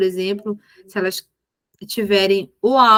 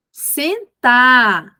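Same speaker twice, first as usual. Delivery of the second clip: louder, firm one-word order; firm command; 2 semitones higher, 6 dB louder than usual. Shown as a demonstration. Teacher to a child who didn't sit, louder firm order. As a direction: raise your voice with clear authority. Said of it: "aumentar o tom de voz gradativamente".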